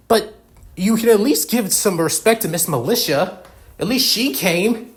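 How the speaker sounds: high-pitched, exasperated scolding; worked-up protest, dragging out the last words; indignant reproach. Acted. Character reproaches another in quarrel.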